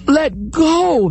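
high desperate yell; shouted. Acted. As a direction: shout again at the turtle. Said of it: "the man shouted".

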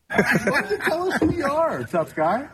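high, heated taunt across a room; yelling across the room. Real, not acted. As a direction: call out across the room at him. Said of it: "[name] is yelling across".

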